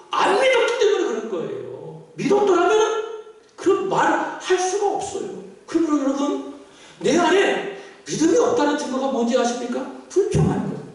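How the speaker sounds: impassioned sermon voice; fervent preaching. Real, not acted. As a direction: declare it firmly from the pulpit. Preaches at full force to congregation.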